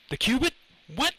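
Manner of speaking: high, startled questioning; baffled question. A narrator's reading. Lenny to the professor about the cubit, high baffled question. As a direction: ask in bewilderment, pitch jumping up.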